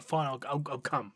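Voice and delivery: soft, low, reluctant mumble; grudging give-in. Retold or imitated. Flesh Tearer caving to the sergeant, a soft grudging mumble. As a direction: concede like it costs you.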